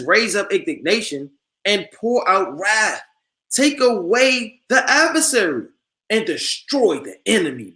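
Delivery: slow solemn verse, rising at adversary; preacher proclaiming the verse; scripture reading. Acted. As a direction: intone the prayer for wrath, building.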